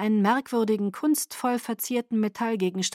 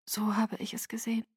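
voiceless, much softer; tense whisper; 2 semitones higher, 7 dB quieter, faster than usual. Acted. Irina answers Caius stiffly in a whisper.

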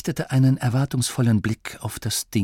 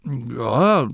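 drawn-out, doubtful agreement; hesitant; 6 semitones higher, slower than usual. Acted. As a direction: agree reluctantly, leaving it hanging. Masklin stimmt zögernd zu. (zögernd, gedehnt)

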